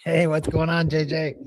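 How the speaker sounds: ordinary delivery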